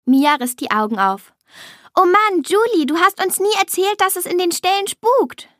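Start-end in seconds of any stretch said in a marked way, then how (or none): 1.9-5.5 put-on high-pitched, alarmed young character; spooked exclamation. A narrator's reading. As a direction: exclaim it high, as if the place is haunted. Spooked girl voiced in story. (aufgeregt und erschrocken, mit hoher Stimme)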